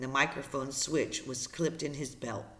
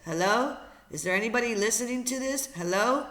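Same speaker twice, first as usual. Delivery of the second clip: higher, rising "anybody there?" call; questioning radio call; 7 semitones higher, 4 dB louder than usual. Acted. Brian calling into the headset for any listener, questioning call. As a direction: call out into an empty channel, pitched up.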